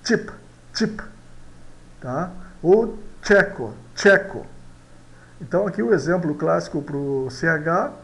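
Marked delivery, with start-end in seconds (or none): 0.0-1.0 "chip" with an initial TCH sound; short separate "chip", repeated as a model; CH pronunciation demo. Shown as a demonstration. Teacher giving an example of C H, pronunciation demo. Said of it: "Que a gente pronuncia". 3.2-4.5 "tcheco" with a firm TCH at the start; CH pronunciation demo. Shown as a demonstration. Teacher giving another C H example, pronunciation demo. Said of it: "O C H de chip".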